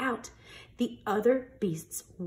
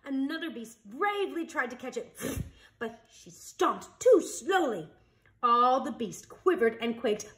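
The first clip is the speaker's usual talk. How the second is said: ordinary delivery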